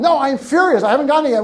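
acting out an angry caller; mock furious customer. Founder imitating an angry customer who got nothing, mock furious.

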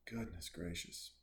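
soft, low aside; hushed, low-key disbelief; muted exclamation. Real, not acted. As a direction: say it quietly, almost to yourself. Same exclamation, then stretched out.

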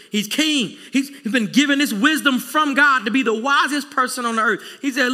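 ordinary delivery

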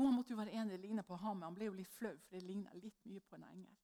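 normal talking voice

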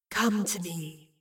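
slow, low, drawn-out summons; slow creeping voice. Acted. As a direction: creep each word into her ears. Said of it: "crawling into her ears so slowly".

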